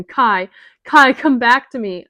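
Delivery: loud, desperate crying call; desperate sobbing call. Acted. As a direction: call him back through sobs. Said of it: "gasping sobs".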